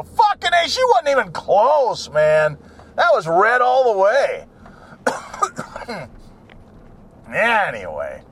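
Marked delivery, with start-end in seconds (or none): 0.0-4.5 higher, hyped-up bystander voice; excited witness. Retold or imitated. Witness to police, hyped-up and high. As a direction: swear it was red, worked up. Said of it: "They go".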